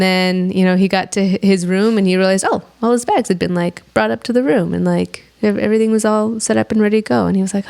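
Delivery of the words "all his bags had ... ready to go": normal talking voice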